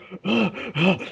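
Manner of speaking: exaggerated workout gasps; breathing hard. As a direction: heave out winded gasps, mimicking exhaustion.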